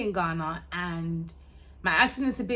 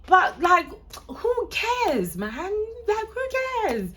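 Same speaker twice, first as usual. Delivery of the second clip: high-pitched exasperated dismissal; indignant, much higher than usual chat; defiant outburst; 13 semitones higher, 6 dB louder, slower than usual. Real, not acted. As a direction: throw it off, voice shooting up high. Brushing off imagined critics.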